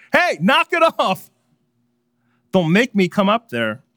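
high, loud call of a fed-up parent; parent yelling upstairs. Retold or imitated. Parent on the couch yelling at arguing kids upstairs, loud and high. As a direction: bark it up the stairs from the couch. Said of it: "You yell from your couch".

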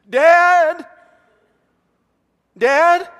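high, loud frightened cry for Dad; scared child calling. Retold or imitated. Son scared at night calling his dad, high and loud. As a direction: call out like a scared boy at night. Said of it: "I know when Jonathan is afraid in the night because of the pitch of his voice".

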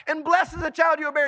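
loud, high, fast cry. Acted. Preacher acting Elizabeth overtaken by the Spirit, crying out. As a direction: cry it out, swept along. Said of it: "she cries out".

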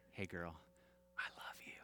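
put-on soft breathy whisper to a child; tender whisper. Dad whispering love to his daughter at bedtime.

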